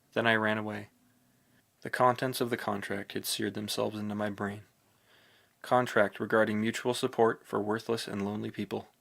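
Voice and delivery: ordinary delivery, nothing marked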